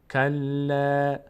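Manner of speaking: کلا chanted slowly with a thin, long "laa"; light lam. Shown as a demonstration. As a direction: hold the end of کلا light, never heavy. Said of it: "اما پر نمیشه". («کلا» کشیده با لامِ نازک)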